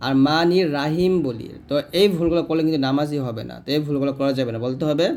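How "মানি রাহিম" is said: "রাহিম" quick, with a light r and plain h; mistaken flat pronunciation. Shown as a demonstration. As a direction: say "মানি রাহিম" without stretching. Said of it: "যে ভুলগুলো করি".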